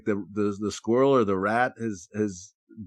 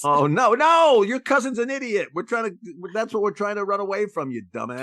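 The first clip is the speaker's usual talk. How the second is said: high, loud mock scolding horse; a horse snapping at a dim friend, voice up high; exasperated horse; 12 semitones higher, 4 dB louder than usual. Runaway horse scolding another horse, high and exasperated.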